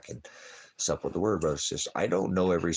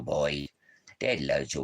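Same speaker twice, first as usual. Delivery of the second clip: normal talking voice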